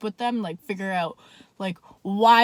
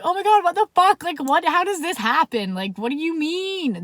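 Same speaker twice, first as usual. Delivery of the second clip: higher-pitched, incredulous outburst; shocked friend; 6 semitones higher, 4 dB louder than usual. Retold or imitated. Friend hearing she has a date with a cop, incredulous. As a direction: imitate a friend reeling at the news.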